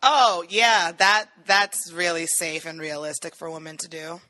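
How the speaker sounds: sarcastic. Real, not acted. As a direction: feign agreement, sarcastically. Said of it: "Was that sarcasm?".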